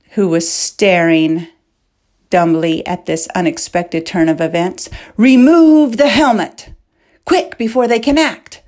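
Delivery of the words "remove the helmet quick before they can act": loud, high urgent command; urgent shout. A narrator's reading. Fleck urges Wara to unmask the knight, urgent shout. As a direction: snap the order, no time to lose. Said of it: "Wara did not need to be told twice".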